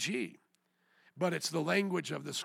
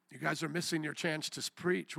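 ordinary talk, nothing marked

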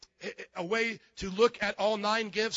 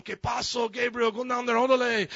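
louder, higher joking Spanish call; mock casual Spanish greeting; 4 semitones higher, 6 dB louder than usual. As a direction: playfully hail an angel in Spanish. Mimics Jesus chatting to Gabriel.

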